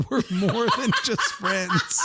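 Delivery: high, squeaky words through laughter; laughing. Real, not acted. Confessing a childhood crush fantasy, laughing. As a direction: crack up while finishing the confession.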